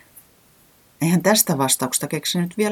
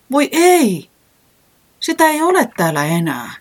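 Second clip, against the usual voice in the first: dismayed cry; 11 semitones higher, 5 dB louder than usual. A narrator's reading. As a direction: wail as the tree is gone. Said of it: "säämies parahti". (voi ei -parahdus korkealla ja kovaa)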